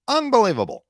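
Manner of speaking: high, loud exaggerated disbelief; mock disbelief. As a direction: ham up the shock on the word. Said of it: "someone says".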